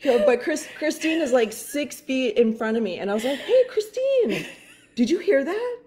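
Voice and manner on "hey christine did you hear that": imitated wary high-pitched call; nervous call. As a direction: call out high, checking on a noise. Retelling nervous call to Christine.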